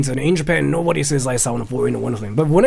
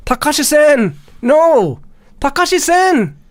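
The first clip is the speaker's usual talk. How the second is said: higher and louder, long wailing calls; calling out; 12 semitones higher, 4 dB louder, much slower than usual. Real, not acted. Host calling out after Takashi dropped from Zoom, loud wail. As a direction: cry out after a lost friend.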